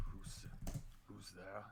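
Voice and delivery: low, hushed call into the dark. Acted. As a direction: drop the voice and ask quietly. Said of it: "he speaks in a bit of a lower tone".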